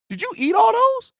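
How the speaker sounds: high, alarmed disbelief; shocked friend. Retold or imitated. Friend asking if he ate all the edibles, high shocked voice. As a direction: ask it like you just saw disaster.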